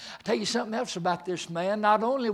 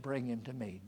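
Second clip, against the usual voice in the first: soft, lower gentle command; quiet command; 6 semitones lower, 9 dB quieter than usual. Acted. Jesus retold softly to the congregation, quiet. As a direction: say it softly, with calm authority.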